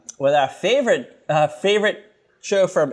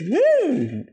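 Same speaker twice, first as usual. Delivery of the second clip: excited whoop; 13 semitones higher than usual. Real, not acted. Cheering show announcement.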